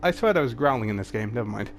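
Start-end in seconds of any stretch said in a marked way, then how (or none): none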